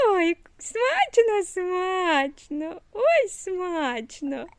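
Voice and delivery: slow, drawn-out tasty sounds; savouring. Real, not acted. Mother showing her baby the ice cream is yummy, slow.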